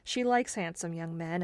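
plain reading voice

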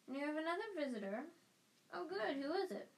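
quick bright matron asking who is there; brisk nurse. A narrator's reading. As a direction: chirp it like a busy matron. Madam Pomfrey asking brightly.

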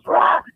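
high-pitched mock battle cry; short, high "rah" as if lunging; imitated lunging roar. Woman diving for the car, high mock roar. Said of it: "She goes".

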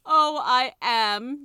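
very loud, high, long-drawn wail; wailing tiger skin. A narrator's reading. Tiger skin insists to Polly it is dead, wailing. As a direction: stretch each word into a loud wail. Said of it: "the tiger skin wailed".

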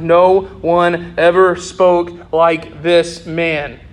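slow, weighty, every word set apart; a slow, solemn stress on ever; slow emphatic. Real, not acted. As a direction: land the main point slowly. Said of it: "this is our main point".

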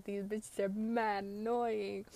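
slow, stretched-out fed-up remark; drawn-out annoyance. Retold or imitated. Sharing a look over annoying friends, slow exasperation. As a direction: drag the words out, fed up.

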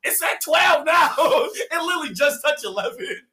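laughing, high-pitched insistence; laughing protest. Real, not acted. Laughing protest over the guess.

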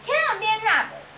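proud Cyclops. A narrator's reading. Cyclops boasts about his magic wool, proud. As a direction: show off with lightly voiced pride. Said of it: "he said proudly".

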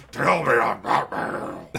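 low, slow gravelly Batman growl; low gravel vigilante growl. Retold or imitated. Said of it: "This is really hard at the vocal cords".

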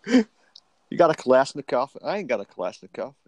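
first-try mock American tough guy; American accent. Co-host bragging about his gun in a mock American accent. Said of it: "I don't think I've ever tried with an American accent".

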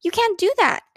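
high-pitched shocked protest; horrified cry. A narrator's reading. Older sister at wasted apples, horrified protest. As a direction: protest in horror, pitch jumping up. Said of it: "cried Beezus, horrified".

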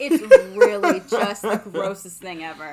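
loud, laughing disgust. Real, not acted. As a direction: laugh through the words. Laughing disgust about old customers.

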